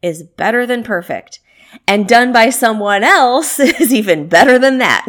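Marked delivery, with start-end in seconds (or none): 1.9-5.1 laughing. Real, not acted. Joke about delegating.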